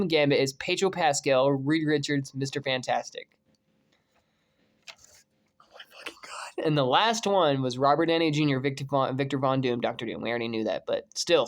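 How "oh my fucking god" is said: breathless whisper of awe; awed whisper. Real, not acted. A fan awed by the Fantastic Four casting, whispering. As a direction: whisper it, overwhelmed.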